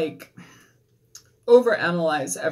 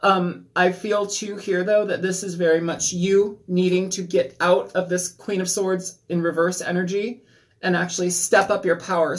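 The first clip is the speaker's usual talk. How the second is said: usual speaking voice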